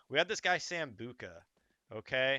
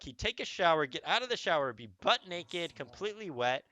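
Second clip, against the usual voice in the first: ordinary talk, nothing marked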